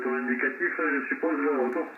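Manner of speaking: normal talking voice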